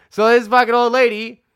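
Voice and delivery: ordinary talk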